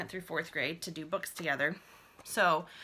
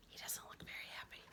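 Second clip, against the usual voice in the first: whispered, fast, confiding; whispered aside; 17 dB quieter, faster than usual. Real, not acted. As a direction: lean in and whisper it. Aside about picture.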